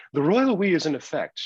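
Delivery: mock posh British accent; playful fake British accent. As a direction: put on a jokey British voice for Madam. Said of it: "Oh, he did the British accent".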